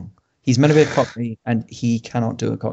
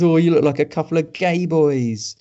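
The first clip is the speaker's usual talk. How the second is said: higher, put-on film-thug voice; mocking hooligan heckler; 4 semitones higher than usual. Reviewer imitating a hooligan's heckle, mocking put-on voice. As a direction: jeer it like a bad film line. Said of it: "someone comes along and goes".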